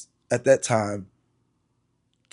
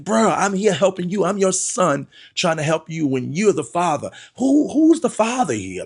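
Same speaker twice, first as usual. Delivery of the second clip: raised, higher and louder frustration; exasperated outburst; 7 semitones higher, 4 dB louder than usual. Retold or imitated. Son confronting his father about drinking, exasperated and loud. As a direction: let the anger at dad spill out.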